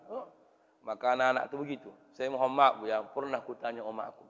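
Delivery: usual speaking voice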